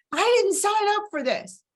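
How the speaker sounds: a high strained wail, half-crying with frustration; high, wailing outburst. Retold or imitated. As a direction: let it break high, exasperated and hurt. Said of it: "and I, you know".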